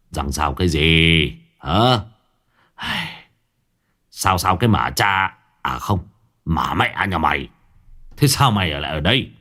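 exasperated, moderately voiced telling-off; scolding. A narrator's reading. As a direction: scold your groggy son, catching your own curse. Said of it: "Ông Đức mắng". (mắng con, bực bội)

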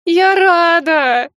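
high, loud, slow sobbing wail; wailing through sobs. Acted. Girl to the marquis, sobbing wail. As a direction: howl it out while crying. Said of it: "всхлипывая, провыла я".

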